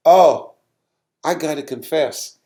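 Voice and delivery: ordinary talk, nothing marked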